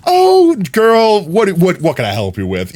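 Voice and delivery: put-on high bright "Oh, girl" then settling; flamboyant friendly. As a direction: gush like a sassy friend. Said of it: "as opposed to".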